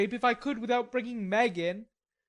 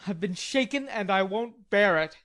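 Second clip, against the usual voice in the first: put-on indignant young man, louder at the end; indignant growl; 2 semitones higher, 3 dB louder than usual. Laurie telling Jo he was shaken, indignant growl. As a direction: growl it out, offended. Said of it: "growled Laurie indignantly".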